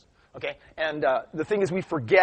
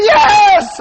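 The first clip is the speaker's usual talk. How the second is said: high, loud drawn-out cry; triumphant teacher; 15 dB louder than usual. Retold or imitated. Teacher hearing the right answer at last, triumphant shout. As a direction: burst out in triumph.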